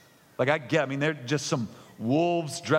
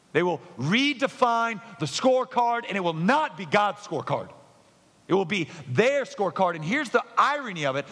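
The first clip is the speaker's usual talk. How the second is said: rising preaching; 8 semitones higher than usual. Acted. Climactic preaching build.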